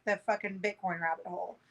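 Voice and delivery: normal talking voice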